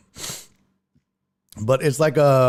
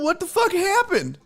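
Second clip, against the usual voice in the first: pitched way up in disbelief; shocked outburst. Retold or imitated. Telling the stream about the ruined steak, shocked high yelp. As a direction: yelp it like the steak betrayed you.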